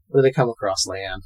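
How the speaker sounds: usual speaking voice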